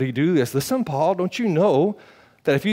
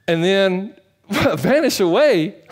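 laughing; 5 semitones higher, slower than usual. Real, not acted. Laughs mid-quote.

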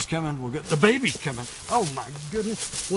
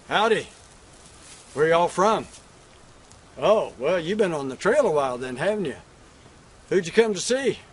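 louder, hearty hello to riders; calling out greeting; 2 semitones higher, 6 dB louder than usual. Acted. Cowboy hailing riders pulling up, loud greeting. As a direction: call out to them across the yard.

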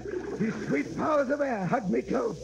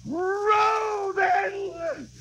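shouted order; 9 semitones higher than usual. Acted. Captain orders the chase.

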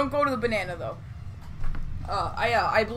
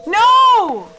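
high, loud cry of alarm; panicked shout; 9 semitones higher, 8 dB louder than usual. Real, not acted. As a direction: yell it out in panic. Caught in game.

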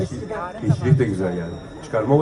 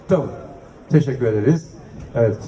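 normal talking voice

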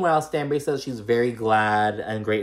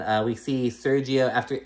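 normal talking voice

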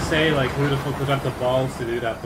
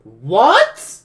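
startled; 6 semitones higher, 13 dB louder than usual. Real, not acted. Startled reaction.

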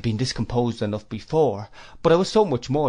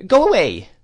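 put-on higher, louder old aunt shooing a boy off; stern shooing; 9 semitones higher, 9 dB louder than usual. A narrator's reading. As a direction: drive the boy away with a sharp command. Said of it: "said Miss Betsy".

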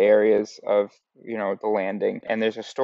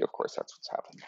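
muttered aside; 14 dB quieter than usual. Real, not acted. Disappointed aside.

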